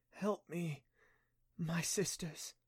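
soft, faint plea; whispering. A narrator's reading. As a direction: breathe it out weakly. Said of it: "Cleo whispered".

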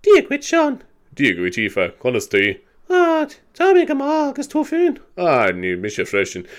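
lightly mocked Munster Irish lilt, jumping high. Imitating Munster Irish on the listening test tape, high squeaky bits. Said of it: "the Munster Irish, as I said, used to be like".

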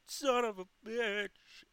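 slow, high falsetto wail of a curse; mock mom yell. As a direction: draw out mom's high-pitched cursing.

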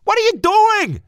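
very high, loud panicked yell; belted shout. Acted. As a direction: belt it out in alarm. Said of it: "Marty belted".

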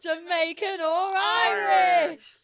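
high, loud, drawn-out announcement; show announcer. Pretend. Host welcoming listeners to the segment, hyped announcer. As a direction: belt out the segment title, stretching the last word.

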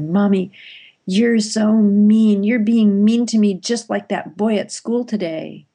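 put-on child wailing at mommy; whiny crying child. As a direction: plead like a sobbing four-year-old. Crying child voiced in story.

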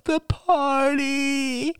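high, slow drawn-out wail; boy wailing about his party, high and slow; child wail. A narrator's reading. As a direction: wail like a heartbroken little boy. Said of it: "Ben wailed".

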